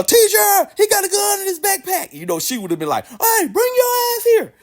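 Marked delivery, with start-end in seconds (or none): 0.0-2.1 high-pitched schoolkid calling out to the teacher; hammed-up squealing alarm; mock tattling shout. Imagined classmate tattling to teacher. 3.1-4.5 high, drawn-out teacher's summons; hammed-up high-pitched scolding; mock stern teacher. Imagined teacher summoning the student.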